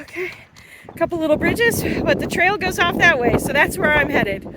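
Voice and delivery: breathless, talking mid-stride; puffing out words on the move; while running. Real, not acted.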